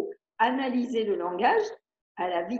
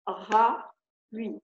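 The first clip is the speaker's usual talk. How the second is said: syllable by syllable; much slower than usual. Shown as a demonstration.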